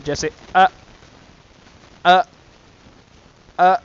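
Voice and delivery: ا with a sharp glottal stop; hamza glottal catch. Shown as a demonstration. Teacher showing the hamza to students, ا with a glottal catch. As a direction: catch the throat on each ا. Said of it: "یعنی حمزہ آگے لگا کے".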